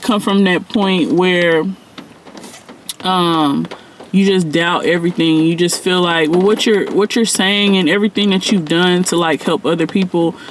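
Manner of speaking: ordinary talk, nothing marked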